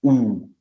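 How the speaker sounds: "ou" said as a loud, isolated oo; damma vowel sound. Shown as a demonstration. Teacher introducing damma's sound to learners, "ou" as a loud oo. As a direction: sound out "ou" on its own, loudly. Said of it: "avec le son".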